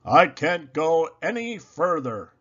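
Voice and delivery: wheezing. A narrator's reading. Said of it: "he wheezes".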